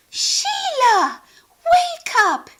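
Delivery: very high, loud plea; urgent call. Acted. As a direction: wake her up fast. Friend calls anxious.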